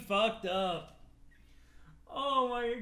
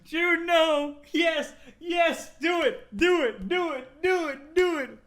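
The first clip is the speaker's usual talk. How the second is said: high, loud, insistent cries; excited shouting; 9 semitones higher, 9 dB louder, slower than usual. Acted. Goading a friend, excited shouts. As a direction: egg them on at full volume.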